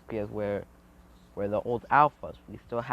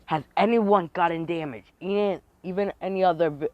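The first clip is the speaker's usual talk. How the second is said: high, raised voice to a crowd; urgent, louder question; calling out; 6 semitones higher, 5 dB louder than usual. Acted. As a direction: call round to check on everyone. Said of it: "looking around, saying".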